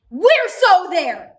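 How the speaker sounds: loud, drawn-out, hammed-up enthusiasm; mock eager agreement. Middle schooler thrilled to join high schoolers, hammed-up eager. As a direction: stretch it out like an overkeen middle schooler.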